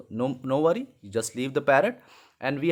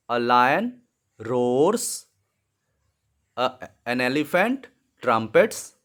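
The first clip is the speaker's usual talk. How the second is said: slow dictation; 2 semitones lower than usual. Shown as a demonstration. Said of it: "putting the sounds in the blank".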